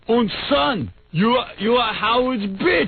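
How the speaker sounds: high mock German accent; German-accented dead daddy. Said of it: "And he'll say".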